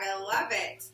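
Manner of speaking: ordinary delivery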